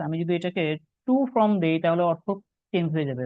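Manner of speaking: normal talking voice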